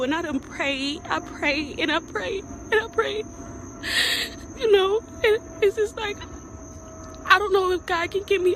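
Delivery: pleading, close to tears; cracking with despair; voice breaking. Real, not acted. Rapper confessing she sold her soul.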